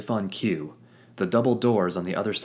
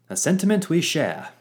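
theatrical rogue voice; 10 semitones higher, 7 dB louder than usual. Acted. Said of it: "Fitz chimed in".

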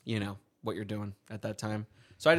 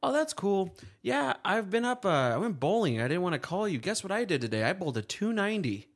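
higher, proud dad boasting; bragging dad; 8 semitones higher than usual. Retold or imitated. Dad telling his son about his bowling score, lightly voiced bragging.

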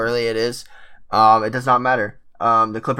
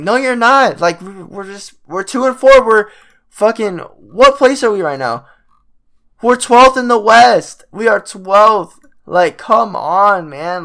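high, loud, slow exasperated yelling; furious yell; 8 semitones higher, 6 dB louder, slower than usual. Real, not acted. Fan yelling at the Clippers about being twelfth, furious and loud. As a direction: shout the standings in disbelief.

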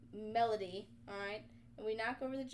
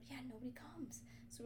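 voiceless whispering; a tense, breathless whisper; 2 semitones higher, 11 dB quieter than usual. Real, not acted. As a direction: whisper it as if hiding.

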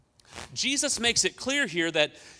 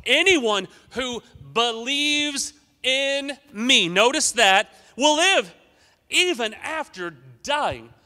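emphatic slow reading; 9 semitones higher, 8 dB louder, slower than usual. Acted. Reading Jesus' words emphatically.

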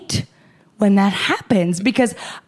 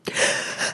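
silent breathy laugh; 9 dB quieter than usual. Real, not acted.

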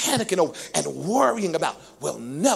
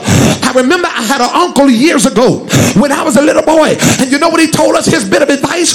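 loud, relentless sermon shout, climbing higher toward the end; full-force preaching; 6 semitones higher, 13 dB louder than usual. Real, not acted. Preacher warns the congregation what panic does to them, full-force preaching. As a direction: drive each phrase home like a fired-up preacher.

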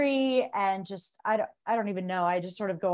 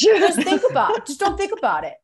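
high, loud mimicry breaking into laughter; laughing mock coach; 10 semitones higher, 8 dB louder than usual. Athlete parodying her coach's advice, laughing through it. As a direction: parody the coach while cracking up. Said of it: "And they just go".

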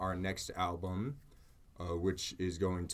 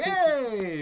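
high, loud, stretched-out "hey"; excited greeting; 7 dB louder than usual. Retold or imitated. Drummer returning the clerk's greeting, excited high holler. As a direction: holler it back, high and long. Acts out the customer greeting back.

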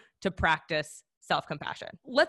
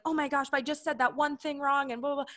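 anxious little self; 6 semitones higher than usual. Retold or imitated. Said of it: "the little version of myself being like".

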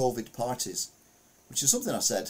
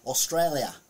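Australian accent; 4 semitones higher, 5 dB louder than usual. Shown as a demonstration. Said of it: "I can do the accent really well".

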